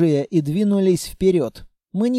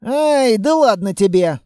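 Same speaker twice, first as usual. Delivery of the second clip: lifted, loud brush-off; light, careless dismissal; dismissive; 5 semitones higher, 5 dB louder than usual. A narrator's reading. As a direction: wave the objection away. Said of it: "отмахнулся Текс".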